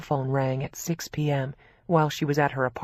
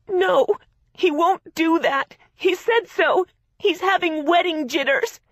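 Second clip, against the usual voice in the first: high, loud tearful denial; 13 semitones higher, 6 dB louder than usual. A narrator's reading. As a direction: deny it through tears. Crying sister denies.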